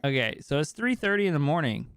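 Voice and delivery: ordinary talk, nothing marked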